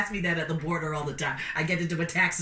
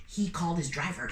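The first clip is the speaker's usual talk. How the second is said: ordinary delivery, nothing marked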